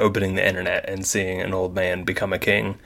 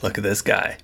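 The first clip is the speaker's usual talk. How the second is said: ordinary delivery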